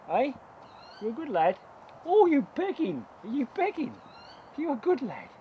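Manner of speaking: high, fond cooing; pet talk. Real, not acted. Falconer cooing at the raven who pecks him, high and fond. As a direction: coo at the raven fondly.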